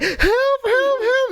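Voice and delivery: very high, squeaky plea; mock cry for help. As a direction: imitate a helpless friend calling out. Listener mimics his plea for help.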